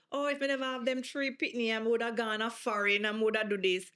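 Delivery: lightly voiced Jamaican parent lamenting; a touch of a complaining Jamaican mother; mild Jamaican patois sigh. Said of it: "where parents say".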